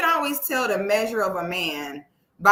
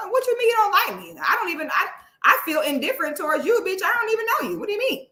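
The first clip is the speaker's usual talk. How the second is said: high, rapid protest; incredulous, high-pitched rebuttal; indignant disbelief; 7 semitones higher, much faster than usual. Acted. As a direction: pitch it up, firing off baffled questions. Indignant reply to a hater.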